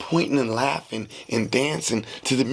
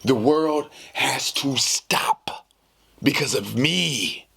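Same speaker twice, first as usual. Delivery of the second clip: slow, drawn-out pronouncement; grand mock declaration; 4 semitones higher, much slower than usual. Telling the audience his selfish logic, slow and grand. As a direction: stretch every word like a decree. Dramatic self-centred declaration.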